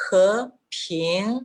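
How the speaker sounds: "he, ping" as one rise split in two; recommended stepped rise. Shown as a demonstration. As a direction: climb in two steps through "he, ping". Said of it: "Así tendríamos".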